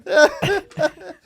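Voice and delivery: laughing apology; giggling while apologizing. Real, not acted.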